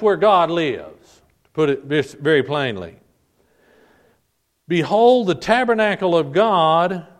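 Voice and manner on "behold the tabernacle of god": raised, loud proclamation; emphatic cry of a heavenly announcement. Acted. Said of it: "cried out from heaven".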